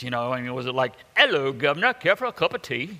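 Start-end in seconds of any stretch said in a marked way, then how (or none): 1.2-3.0 put-on hammy movie British accent, pitch up; mock British accent. Preacher joking God spoke to Moses in a silly British accent. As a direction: put on a cheesy British accent offering tea. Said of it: "in a British accent like they always do in the movies".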